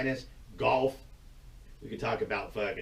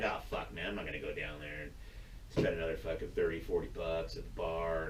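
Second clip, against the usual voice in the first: mopey grumble; 7 semitones lower, 7 dB quieter than usual. Retold or imitated. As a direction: drag it like a gloomy fan. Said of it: "you're like".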